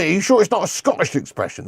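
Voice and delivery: ordinary talk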